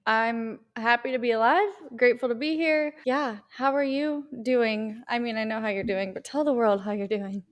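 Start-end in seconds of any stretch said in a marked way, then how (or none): none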